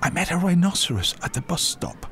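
high-pitched schoolboy; young boy. A narrator's reading. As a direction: report it high, as a small boy. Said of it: "he said".

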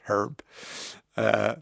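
ordinary talk

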